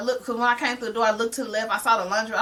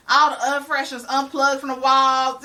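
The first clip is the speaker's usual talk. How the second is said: ordinary delivery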